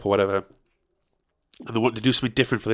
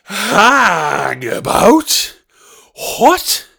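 very high, drawn-out what; stunned disbelief; 8 semitones higher, 6 dB louder, much slower than usual. Real, not acted. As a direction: stretch it out in shock. Disbelief at prize.

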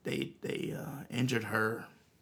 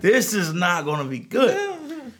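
put-on oh boy; 6 semitones higher, 11 dB louder than usual. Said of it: "and I'm like".

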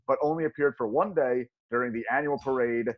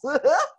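laugh; 5 dB louder than usual. Real, not acted. Laughs after goofy voice.

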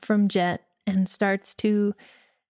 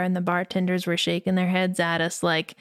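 ordinary delivery

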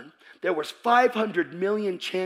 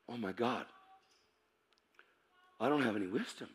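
very soft, low murmur; stunned realization; 5 semitones lower, 11 dB quieter, faster than usual. Retold or imitated. Reader discovering what he lacks, hushed and stunned. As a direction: let it dawn on you quietly, with a long pause.

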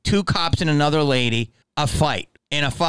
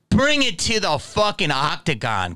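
ordinary delivery

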